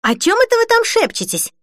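high, suspicious questioning; jealous. A narrator's reading. Denise catching her friends whispering, jealous. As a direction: pitch it up sharply, jealous of the secret. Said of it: "ревниво спросила Денис".

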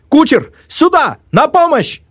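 very high shouted calls; calling for help. A narrator's reading. Narrator calls coachman from the window, shouting. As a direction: shout down to the street.

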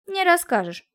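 high, confident comeback; quick dismissive counter; parrying retort. A narrator's reading. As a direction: call her bluff. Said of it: "парировал Алексей".

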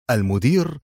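alarmed question, close to panic; near panic. A narrator's reading. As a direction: ask it with a touch of panic. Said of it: "بصوت أقرب إلى الذعر". (بنبرة أقرب إلى الذعر)

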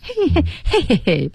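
high-pitched mock he-he chuckle; imitated laugh. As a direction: mimic a "hey-hey-hey" laugh. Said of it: "这是一种黑人的笑声".

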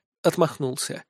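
plain reading voice

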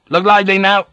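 urging call. A narrator's reading. Captain urging Ginsel ashore, high loud call. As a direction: hurry him along with a high call.

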